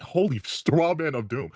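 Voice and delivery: high, slow mocking exclamation; drawn-out sarcastic cry; mock outrage. Real, not acted.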